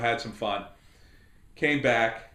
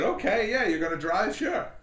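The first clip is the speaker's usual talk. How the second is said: doubtful agreement; 10 semitones higher than usual. Retold or imitated. Wary agreement to her driving.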